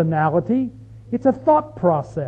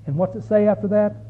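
ordinary talk, nothing marked

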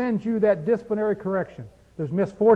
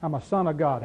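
softer and lower, offhand and knowing; low wry aside; 6 semitones lower, 6 dB quieter than usual. Pretend. Pastor voicing a believer's resume, low and wry. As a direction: drop it low like a private joke. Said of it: "Next resume you fill out".

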